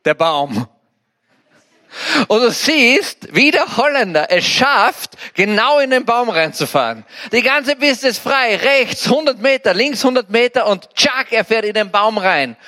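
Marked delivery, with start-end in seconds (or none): 2.3-12.6 high-pitched, animated telling of the crash; excited storytelling. Retold or imitated. Preacher telling the congregation about the skier's crash, high and excited. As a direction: build up to the tschack with disbelieving glee. Retelling the skier hitting the tree.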